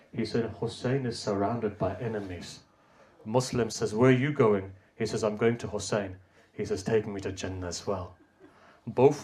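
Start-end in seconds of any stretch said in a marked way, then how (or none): 0.1-8.1 softer, hushed storytelling; hushed telling. Acted. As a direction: draw the mourners in with a low murmur. Hushed dialogue retelling.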